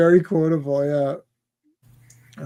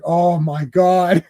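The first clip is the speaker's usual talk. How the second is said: dismayed groan; much slower than usual. Retold or imitated. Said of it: "And he's like".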